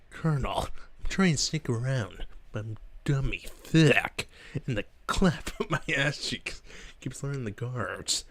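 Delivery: lightly voiced gruff soldier; a hint of a grizzled spy; Solid Snake voice. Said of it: "a Solid Snake impression".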